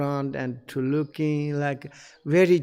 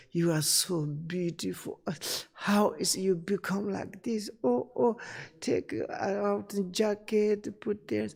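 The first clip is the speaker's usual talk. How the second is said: higher, soft, gushing flattery; fawning restaurant owner; 7 semitones higher, 5 dB quieter than usual. Retold or imitated. Restaurant owner sweet-talking a girl customer, high soft gushing. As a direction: coo over her like a smooth host. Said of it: "this said to this girl".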